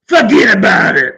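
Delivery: put-on loud New York mobster accent; [name]'s mobster accent. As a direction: bark it like a New York wiseguy, imitating. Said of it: "he can talk like a mobster".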